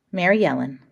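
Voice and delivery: ordinary delivery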